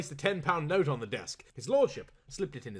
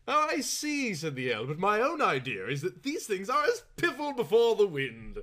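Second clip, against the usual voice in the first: aristocratic Earl; 7 semitones higher, 3 dB louder than usual. Acted.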